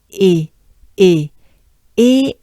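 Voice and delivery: "E" as a French e, air up in the mouth. Shown as a demonstration. Teacher contrasting the second E sound. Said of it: "will sound very similar".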